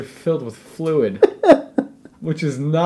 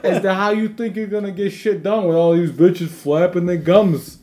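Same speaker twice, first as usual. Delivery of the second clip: mock Bill O'Reilly; 6 semitones higher, 4 dB louder than usual. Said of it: "Bill O'Reilly, uh, Fox quote-unquote news".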